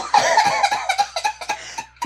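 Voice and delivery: high burst of laughter; cracking up at his own joke; big laugh. Real, not acted. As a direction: break up laughing.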